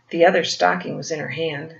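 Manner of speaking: ordinary delivery, nothing marked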